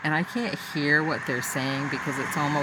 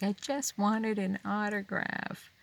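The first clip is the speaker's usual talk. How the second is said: higher, soft, whimpering little voice; sniffling fan; 7 semitones higher, 5 dB quieter than usual. Retold or imitated. Tearful fan telling guards she only wanted an autograph, soft whimper. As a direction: pout it out like a let-down fan. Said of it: "she calms down and she's like".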